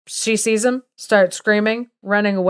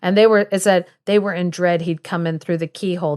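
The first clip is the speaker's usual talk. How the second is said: usual speaking voice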